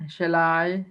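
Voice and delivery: shall run into a stressed, lengthened I; iambic foot. Shown as a demonstration. Teacher giving an example of a foot, stress on I. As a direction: scan "Shall I" as one foot, weight on I. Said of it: "Here is an example".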